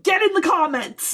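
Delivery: high excited call to listeners; rally the audience. Real, not acted. Calling out to listeners.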